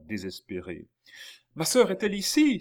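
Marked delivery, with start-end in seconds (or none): 1.5-2.6 high, loud, fast desperate question; shouting from afar. A narrator's reading. Desperate brother to the group at the door, shouting from afar. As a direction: shout it urgently across the distance. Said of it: "s'écria-t-il du plus loin".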